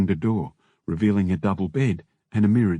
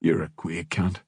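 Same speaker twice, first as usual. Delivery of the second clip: low-key, deadpan put-down; dry; 4 semitones lower, 4 dB quieter than usual. A narrator's reading. Winnie glancing back at Kev, dry delivery. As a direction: drop it quietly, without any lift. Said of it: "said dryly".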